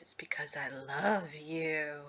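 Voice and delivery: low, slow, hushed creepy lover; stalker voice. Acted. Player voicing his confession of love, low creepy stalker voice. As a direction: draw it out softly like an obsessed stalker. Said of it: "like, stalker voice".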